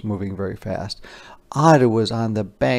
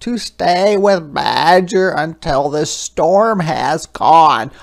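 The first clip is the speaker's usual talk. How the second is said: higher, slow, earnest little hedgehog; a small creature deciding bravely; 5 semitones higher, 7 dB louder, slower than usual. Acted. Storyteller voicing Little Hedgehog, higher and slow.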